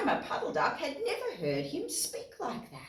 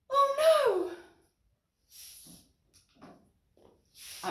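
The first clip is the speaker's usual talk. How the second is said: high, alarmed cry; mock alarmed cry for the duck. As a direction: gasp it out in alarm. Alarmed exclamation.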